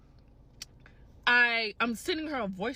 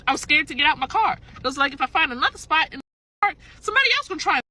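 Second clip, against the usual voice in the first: loud, high, rushed exasperation; worked-up venting; 4 semitones higher, 8 dB louder, much faster than usual. Real, not acted. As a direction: blurt it out loud, climbing higher. Venting, upset at the encounters.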